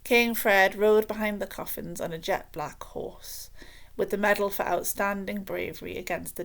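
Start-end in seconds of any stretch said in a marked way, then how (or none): none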